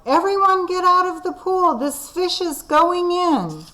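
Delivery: high, loud yell. Acted. Firemen yelling at swimmers to get out, loud high yell. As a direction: clear the pool with a shout. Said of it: "The firemen yelled".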